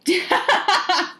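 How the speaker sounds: high, loud burst of laughter; laughing. Real, not acted. Laughing after teasing list.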